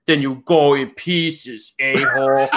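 slow tough action-hero one-liner; Arnold Schwarzenegger voice. Retold or imitated. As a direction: deliver it like a cheesy action hero. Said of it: "you almost turned into Arnold Schwarzenegger".

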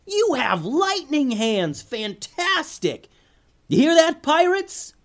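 very high, slow, squealing character voice; Sam's gleeful yell. A narrator's reading. Sam crows to the pirates about lightning hands, high-pitched yelling. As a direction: yell it sky-high, words stretched out. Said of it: "Sam yelled to no one in particular".

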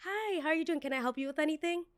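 fake-sweet welcome, fast at the end; a server greeting a rude guest with a forced cheery tone; sugary host greeting. Shown as a demonstration. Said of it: "exactly how it happened".

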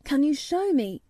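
lightly voiced eager boy; eager cry. A narrator's reading. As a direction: ask it eagerly, voice lifting. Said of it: "he cried".

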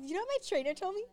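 imitating Oscar. Imitating a friend telling her trainer's advice, high and bubbly. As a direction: play the friend eagerly sharing a tip. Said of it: "she's like".

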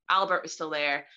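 ordinary talk, nothing marked

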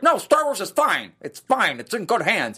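high, slow mock fanboy. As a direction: brush it off like a fan. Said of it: "people are like".

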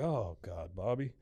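low, soft groaning dismay; dismayed Hank. Retold or imitated. Hank reacting to his son's trouble, quietly dismayed. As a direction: sink into a quiet, weary reproach. Hank's dismayed reply, acted.

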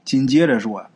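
usual speaking voice

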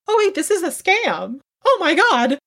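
very high, squeaky dim-witted voice; ditzy, high-pitched mock epiphany; mock sudden realization. Mocks a dim person's sudden realization.

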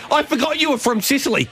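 normal talking voice

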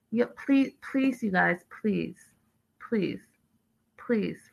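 soft, exasperated begging; hushed pleading. Acted. Begging viewers to just travel, hushed exasperated plea. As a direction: drop your voice and beg, pausing between pleas.

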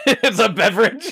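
high-pitched question through giggles; laughing incredulously. Real, not acted. Host amused by co-host's wild guess, laughing. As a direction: crack up while echoing the guess.

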